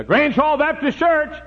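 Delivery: high, loud, slow answering voice; proud phone greeting. Retold or imitated. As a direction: answer the church's first phone with pride. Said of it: "I'd say".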